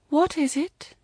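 high, otherworldly question; questioning voice of the shrine. A narrator's reading. Voice at the shrine asks her wish, high and questioning. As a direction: ask it from on high.

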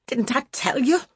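high, rough scolding; rough cry. A narrator's reading. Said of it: "she cried roughly".

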